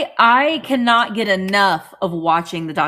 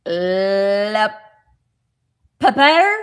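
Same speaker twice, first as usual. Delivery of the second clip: tentative French reading, rising at the end; French accent; 6 dB louder, much slower than usual. Acted. Reading a shaker label aloud, unsure French accent. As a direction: try LE PEPPER in French, questioning and loud. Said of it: "They're in French".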